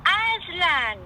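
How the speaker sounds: high, loud, overjoyed cry; Lucy's joyful cry. Acted. Lucy finds Aslan alive, crying out high and joyful. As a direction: burst out with disbelieving joy. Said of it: "Lucy cried".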